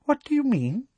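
very high, thin demand; high, thin disbelief. A narrator's reading. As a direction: pitch the question right up, thinly. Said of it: "her voice thinner and higher than ever".

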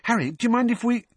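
high, quick and eager; Hermione. A narrator's reading. Girl asking to open her friend's letters, eager. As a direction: rush out the request, pitched right up. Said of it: "said Hermione eagerly".

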